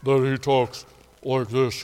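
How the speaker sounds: low, slow, deep bass impression; Hunter Dude's deep voice. Shown as a demonstration. Showing how the guide talks, a slow low voice. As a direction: drop the voice low and drag it like the hunter. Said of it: "whose voice is so deep".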